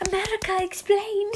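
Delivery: high put-on meme voice; Vine imitation.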